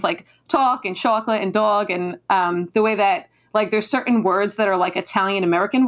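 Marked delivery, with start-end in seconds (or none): 0.5-1.9 talk, chocolate and dog with rounded "aw" vowels; New Jersey vowels. Shown as a demonstration. New Jersey native showing local pronunciation, "aw" in talk, chocolate, dog. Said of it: "the way we pronounce things".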